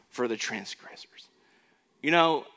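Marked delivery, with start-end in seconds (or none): 0.1-1.3 whisper. Acted. Preacher whispers the verse ending.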